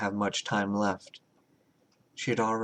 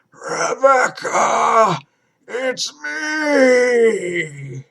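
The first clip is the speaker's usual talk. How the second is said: very slow, high, loud warped cry; distorted monster cry; 12 semitones higher, 10 dB louder, much slower than usual. A narrator's reading. Creature breaking in, crying out to Rebecca. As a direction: wail her name through the door. Creature calling through door.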